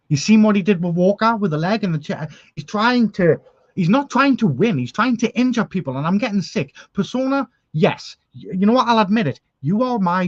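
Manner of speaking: worked-up rant. Real, not acted. General manager venting to fans about Persona, heated. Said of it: "I do get emotional about things like this".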